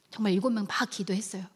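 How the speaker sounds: normal talking voice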